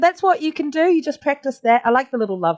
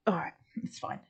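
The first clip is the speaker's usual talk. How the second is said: whispered to self; 3 semitones lower, 15 dB quieter than usual. Real, not acted. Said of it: "whispered aside to herself".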